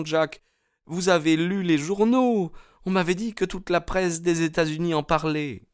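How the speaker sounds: put-on high, urgent young woman; Etty, anxious warning. A narrator's reading. Young woman warns suitor about the gang, high anxious voice.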